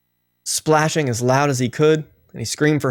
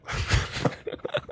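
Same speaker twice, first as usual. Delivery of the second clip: real laugh; 9 dB quieter than usual. Real, not acted. Laughs after telling his joke.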